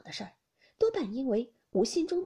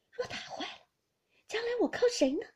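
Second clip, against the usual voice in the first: despairing mother near tears; tearful lament; 12 semitones higher than usual. Acted. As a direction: cry out fearing for her only son. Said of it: "由不得滚下泪来".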